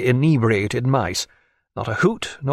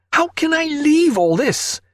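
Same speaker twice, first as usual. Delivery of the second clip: crying out; slower than usual. A narrator's reading. Naturalist protesting to his friend, high cry. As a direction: burst out in dismay.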